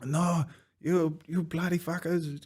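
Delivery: put-on high, strained old man shouting back; agitated old patient. Old patient cursing the orderlies, high mock old-man voice. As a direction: play the frightened old man lashing out.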